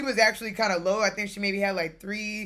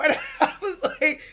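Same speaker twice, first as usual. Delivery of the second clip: laughing; 9 semitones higher, 4 dB louder than usual. Real, not acted. Laughing while retelling.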